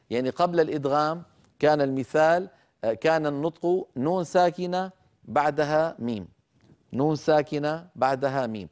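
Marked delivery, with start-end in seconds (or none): none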